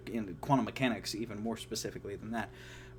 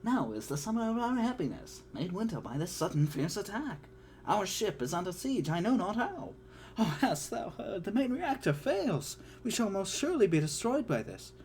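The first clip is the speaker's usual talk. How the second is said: high, fussy robot voice in Shakespearean verse; a worried butler droid reciting iambic verse; C-3PO in Shakespeare; 8 semitones higher than usual. Acted. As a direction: declaim much higher like a panicky droid. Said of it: "enter C three P O".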